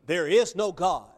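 a loud, flat declaration of no God; bold declaration. Retold or imitated. Preacher voicing a bold unbeliever, loud declaration. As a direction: state it boldly and loudly, as a final verdict. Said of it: "A person just boldly says".

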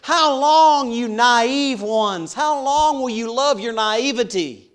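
high, loud cry of How long. Acted. Wisdom crying out to naive people, loud high plea. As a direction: call out to the simple ones. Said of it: "the word simple means naive".

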